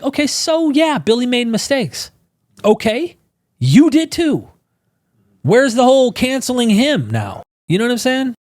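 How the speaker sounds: real upset in defense of a friend; emotional outburst. Real, not acted. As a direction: push back hard, voice climbing in outrage. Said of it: "Sorry, I'm getting emotional about that".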